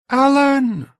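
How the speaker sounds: high, loud woman's call from afar. A narrator's reading. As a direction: call down to someone on the rim below. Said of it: "I could hear someone up on the rim calling".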